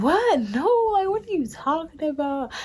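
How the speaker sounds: high, baffled disbelief; retold stunned what-are-you-saying; incredulous disbelief. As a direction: react like he's talking nonsense. Reacting to his monster remark.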